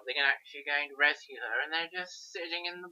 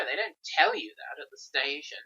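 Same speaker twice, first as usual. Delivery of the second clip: usual speaking voice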